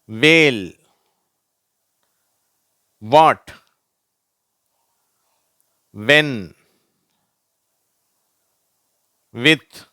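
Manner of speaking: isolated model words வேல், வாட், வென், வித், ஒயிட், each starting on w; emphatic word list, long pause after each; W sound, silent H. Shown as a demonstration. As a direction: read each wh word as w for learners. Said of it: "இவ் என்ற உச்சரிப்பு வருது".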